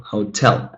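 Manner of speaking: "hotel" stressed on TEL; stress on second syllable. Shown as a demonstration. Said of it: "let's stress the second syllable".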